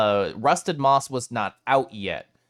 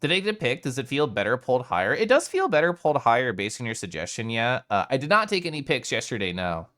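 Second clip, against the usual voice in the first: usual speaking voice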